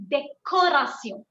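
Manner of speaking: décoration slowly, with a hard "ko" in the middle; hard K sound. Shown as a demonstration. Teacher answering about the C, décoration with a clear K. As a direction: stress the K in décoration, "day-KO-ra-syon". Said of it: "First one gets a K sound".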